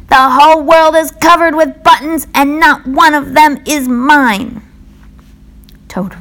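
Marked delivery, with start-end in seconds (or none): 0.1-4.6 high, loud furious scream. A narrator's reading. Toad screaming in rage about all the buttons.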